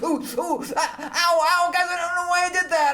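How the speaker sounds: high, hammed-up whimpering ows; whiny pained rocker. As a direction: squeal like a big baby in pain. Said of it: "Did he go".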